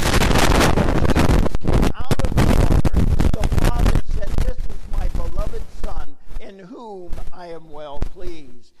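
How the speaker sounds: booming full-force preacher voice; preaching. Acted. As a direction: proclaim it loud from the pulpit.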